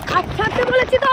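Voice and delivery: high, exasperated shout; sharp, high-pitched outburst; angry demand. Real, not acted. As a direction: order him off, voice shooting up. Demands he release her hand.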